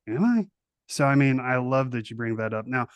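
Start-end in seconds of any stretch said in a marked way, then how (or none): 0.1-0.5 high, startled "Am I?"; a sudden mock double-take, pitched up; surprised. Mock reply to his therapist.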